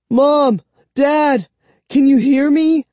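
high, loud call to parents; shout for help. A narrator's reading. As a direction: yell through a door for help. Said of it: "I shouted".

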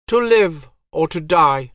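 high, cold divine voice; goddess voice. Acted. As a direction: speak as an aloof goddess. Goddess speaks.

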